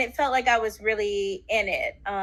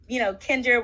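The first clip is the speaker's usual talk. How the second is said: ordinary talk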